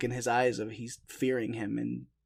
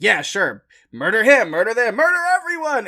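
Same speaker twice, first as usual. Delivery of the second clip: sarcastic mock permission, rising higher; 10 semitones higher, 11 dB louder than usual. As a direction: drip sarcasm, voice climbing high. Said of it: "he's being sarcastic".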